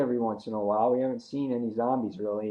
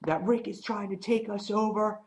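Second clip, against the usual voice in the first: ranting Nicholas; 10 semitones higher, 3 dB quieter than usual. Retold or imitated. Nicholas ranting at the group, much higher. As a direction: demand an answer from the group. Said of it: "He's like".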